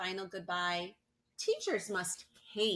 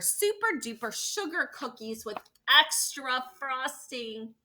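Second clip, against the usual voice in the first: relishing; 6 semitones higher, 6 dB louder than usual. A narrator's reading. Relishing treat description.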